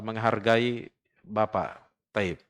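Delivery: usual speaking voice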